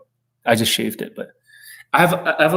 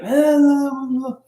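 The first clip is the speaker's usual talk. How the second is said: mock attacker grunts; 12 semitones higher, slower than usual. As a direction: ham up the charging attacker's cries. Attacker lunging with a knife.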